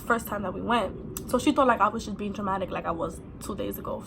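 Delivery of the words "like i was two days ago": ordinary talk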